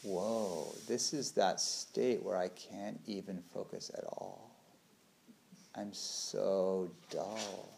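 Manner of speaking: foggy, heavy-lidded musing; dull and drowsy. Retold or imitated. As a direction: talk as if sinking into sleep. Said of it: "is like".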